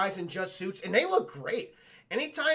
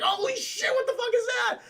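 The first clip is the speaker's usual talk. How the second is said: very high startled squawk; mock startled panic; 3 dB louder than usual. Mummy is startled by what he sees, mock panic. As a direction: blurt it out in high-pitched mock alarm.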